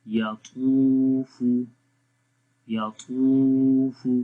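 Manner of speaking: "यतूफू" slow, middle "तू" held long and strong; long twaa. Shown as a demonstration. Teacher models madd for learners, "यतूफू" with a long middle syllable. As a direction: stretch the "तू" in "यतूफू". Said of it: "त्वा को भी लंबा करेंगे".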